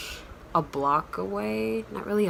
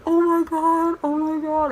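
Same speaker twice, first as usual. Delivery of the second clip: much higher and louder starstruck oh my god; starstruck squeal; 12 semitones higher, 6 dB louder than usual. Retold or imitated. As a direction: shriek like a fan meeting her idol. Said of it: "I was going".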